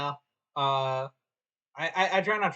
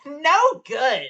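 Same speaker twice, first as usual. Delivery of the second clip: cocky hero snap; Sonic energy; 11 semitones higher, 10 dB louder than usual. Acted. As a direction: bark it like Sonic. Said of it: "Sonic the Hedgehog energy with the way you read that line".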